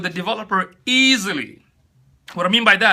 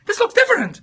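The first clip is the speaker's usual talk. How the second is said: much higher, shrill panicked buyer voice; mock freak-out; 13 semitones higher than usual. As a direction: play a customer panicking over a purchase. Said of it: "you're gonna freak out like".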